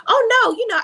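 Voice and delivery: high, loud mock Simone gush. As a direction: ham up Simone's fake brightness. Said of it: "And Simone was like".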